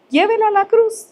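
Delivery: high ringing call from the pulpit; fervent exhortation. Acted. Preacher urging listeners to bring burdens, high fervent call. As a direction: cry it out to the people.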